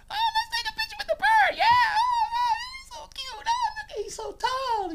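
put-on very high girlish squeal; excited girls. Girls spotting the bird for a picture, squealing. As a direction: gush loudly in hammed-up falsetto. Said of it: "and they're like".

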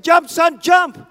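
loud, high commanding shout; father's call. Retold or imitated. Father shouting to his son on the ledge, loud and commanding. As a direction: call up to your son over the fire. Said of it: "the voice said".

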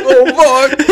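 high laughing oh no; laughing panic. Retold or imitated. Caught farting in class.

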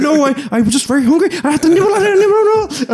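high, silly falsetto excuse; sheepish priest. Retold or imitated. Priest voices himself excusing the nibbling, high and silly. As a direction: squeak out a guilty excuse. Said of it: "I was like".

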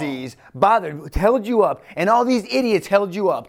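high, heated complaint; indignant protest. Acted. Jairus blaming the crowd that held Jesus up, indignant.

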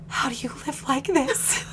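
small, high, soft stricken voice; imitated serious dismay. Anxious husband in bed to his wife, imitated earnest dismay. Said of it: "looks at me so seriously".

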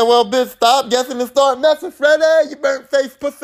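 hammed-up street tough voice; mock Kincaid taunt. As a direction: squeal out a trash-talking dare. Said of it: "And when he said".

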